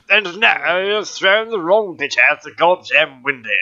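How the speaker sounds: exaggerated posh British, pitched high; high, over-the-top British toff. Shown as a demonstration. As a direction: ham up an outraged English gentleman. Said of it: "take on a proper British posh voice".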